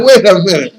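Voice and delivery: loud mock astonishment; amused surprise. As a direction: act out a double take, laughing around it. Retold surprise at the accent.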